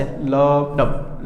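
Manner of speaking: लब drawn out long, डब short and low; slow lub dub. Shown as a demonstration. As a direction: stretch out the लब, clip the डब. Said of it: "लब होता है वो थोड़ा सा लंबा होता है ऐसे". (धीमा लब डब, लब लंबा खींचकर)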